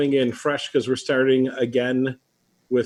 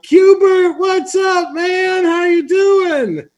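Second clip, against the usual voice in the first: much higher, loud fake buddy shout; overexcited greeting; 5 dB louder, much slower than usual. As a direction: greet a stranger like a long-lost best friend. Acted like he was my best friend.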